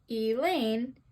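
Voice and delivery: "Elaine" split slowly, weight on the second syllable; stress on second syllable. Shown as a demonstration. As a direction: say "Elaine" with a light E and a stressed LAINE. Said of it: "E is unstressed and Lane is stressed".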